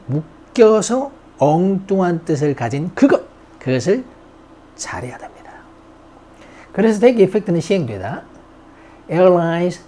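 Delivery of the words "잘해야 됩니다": whisper. Real, not acted. Aside while teaching vocabulary.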